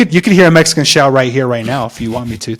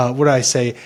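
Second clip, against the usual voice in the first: normal talking voice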